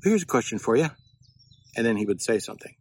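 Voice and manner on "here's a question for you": lightly voiced coworker, pitched higher with a rising ask; a hint of the chatty coworker opening a chat; mock coworker catchphrase, brighter and higher; imitating a coworker. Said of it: "this guy would begin every sentence he would say".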